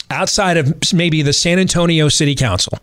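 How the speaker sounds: ordinary delivery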